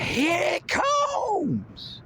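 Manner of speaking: very high, stretched-out call; drawn-out yell. Pretend. Hyping the crowd, he stretches out a high, slow call. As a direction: drag the yell out long and high. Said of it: "I'll be yelling things like".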